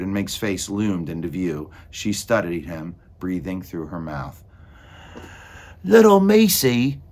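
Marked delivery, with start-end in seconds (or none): 5.8-7.0 high, loud, slow cooing; coaxing character voice. A narrator's reading. Meg speaks to the little mouse, cooing loudly. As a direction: call to a tiny creature, slow and high.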